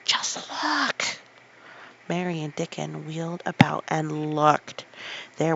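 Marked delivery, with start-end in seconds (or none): 0.0-1.1 excited whisper. Acted. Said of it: "he whispered excitedly".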